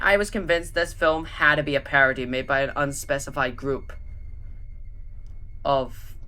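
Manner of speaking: ordinary delivery, nothing marked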